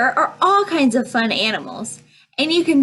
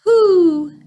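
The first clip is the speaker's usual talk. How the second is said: high, loud, sustained hoots; long high storybook owl calls; 9 semitones higher, 6 dB louder, much slower than usual. A narrator's reading. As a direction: hoot like an owl. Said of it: "says the owl".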